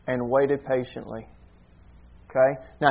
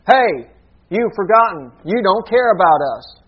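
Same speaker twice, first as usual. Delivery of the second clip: loud, raised accusing protest; demanding complaint; 4 semitones higher, 5 dB louder than usual. Acted. Disciples protesting to the Lord that he forgot them, demanding. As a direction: call out an indignant mock complaint. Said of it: "There was a demand of".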